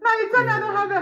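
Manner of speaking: slow, tearful words; real crying, slowed right down. Real, not acted. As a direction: speak through tears. Mother breaking down on camera.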